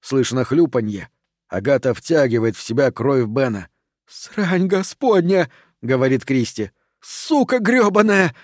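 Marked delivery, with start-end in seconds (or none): 4.1-5.5 slow, high-pitched shocked oath; horrified exclamation. A narrator's reading. Кристи в ужасе от увиденного. 7.0-8.3 slow, high-pitched furious curse; shouted curse. A narrator's reading. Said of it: "кричит Меган".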